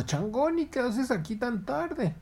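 heron squawking in surprise; high heron voice. Acted.

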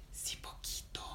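soft coaxing whisper. Acted. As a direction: Patinina pleads softly in a whisper. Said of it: "Y Patinina dijo".

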